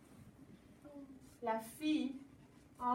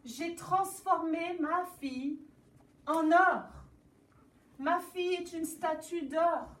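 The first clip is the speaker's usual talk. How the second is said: loud, higher, distressed explaining; king pours out his trouble, louder; upset king; 5 semitones higher, 6 dB louder than usual. Acted. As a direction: blurt out the problem in distress. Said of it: "Le roi dit".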